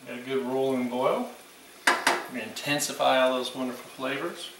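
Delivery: ordinary talk, nothing marked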